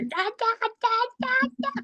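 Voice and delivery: put-on high, childlike "da da da"; imitating toddler. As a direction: mimic a baby yelling at dad. Imitating her child screaming.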